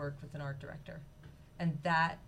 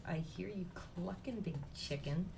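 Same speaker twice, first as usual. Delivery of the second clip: softer, slow grumble of a fed-up chick; annoyed little chick; 6 dB quieter than usual. A narrator's reading. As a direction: drag it out, mildly annoyed. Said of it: "he's sort of a little annoyed".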